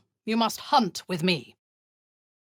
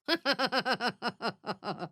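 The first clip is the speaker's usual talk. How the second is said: dark chuckle; 6 semitones higher, 5 dB quieter than usual. Acted. Gloating before a hunt.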